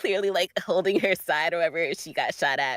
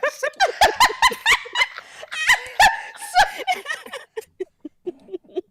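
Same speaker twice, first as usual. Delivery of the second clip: high shrieking laugh of triumph; real delighted shriek-laugh; loud gloating cackle; 8 dB louder than usual. Real, not acted. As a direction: howl with laughter at your own burn. Gloating after insult.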